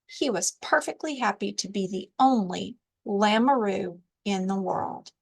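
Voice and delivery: ordinary delivery, nothing marked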